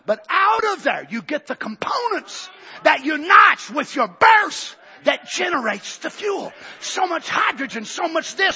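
high, loud, crying preacher's shout; preaching at full force. Real, not acted. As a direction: pour it out over the congregation.

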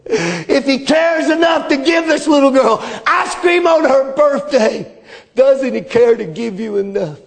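preacher urging the church, full voice; preaching. Acted. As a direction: press it home to the congregation. Preaching appeal to congregation.

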